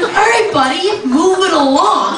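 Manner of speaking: high-pitched, drawn-out brush-off; brisk dismissal. Acted. Telling a creepy stranger to leave, high dismissive voice.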